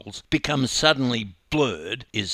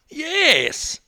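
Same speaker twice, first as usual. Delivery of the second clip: high, loud, aggrieved yes; wailing insistence; 5 dB louder than usual. A narrator's reading. Upset swimmer insists he was kicked.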